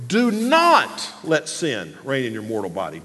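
"do not" hit hard, pause, rest drops away; stress on NOT. Shown as a demonstration. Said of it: "emphasize a different word every time".

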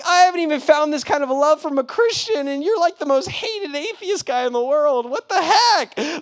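can't believe the atheist's kindness; patient exclaiming in disbelief at his enemy's love; shocked disbelief. Retold or imitated. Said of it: "And I'm like".

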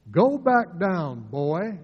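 a hint of a Southern bully drawling it. King's priest telling Amos off, lightly Southern. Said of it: "You can almost hear the accent of his voice".

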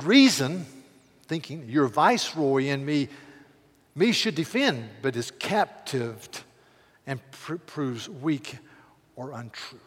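slow, weighty poem reading. Acted. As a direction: read the sonnet with weight. Continues the Donne poem.

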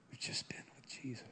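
hushed, reverent whisper; barely voiced, intimate repeat. Real, not acted. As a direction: whisper it to the congregation.

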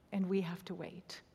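hushed whisper, drawn low; whispered reflection. Real, not acted. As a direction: breathe it out softly to the room. Quiet sermon close, whispered.

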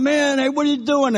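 loud, high indignant yell; inmates yelling. Retold or imitated. TV-room inmates yelling at the evangelist, loud and high. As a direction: shout at him for killing the TV. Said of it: "everyone starts yelling".

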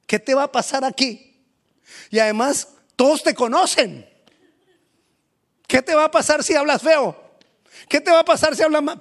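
high, loud insistent questions; pressing challenge. Acted. A preacher pushing a shy believer up, loud high insistence. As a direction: push him hard to get on stage. Urging a frightened person.

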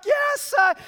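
very high victory yell; jubilant cheer. Acted. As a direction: shout it while hoisting your son. Dad cheering son's goal.

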